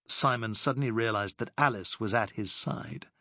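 ordinary delivery

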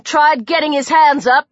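usual speaking voice

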